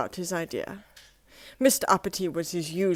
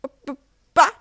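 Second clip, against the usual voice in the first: higher, stuttering b-b-but; Mister Happy stammering at the refusal, higher; stammering protest; 7 semitones higher, 10 dB louder, much faster than usual. Acted. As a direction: trip over the first sound in dismay. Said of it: "said Mister Happy".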